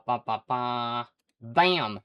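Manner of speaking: usual speaking voice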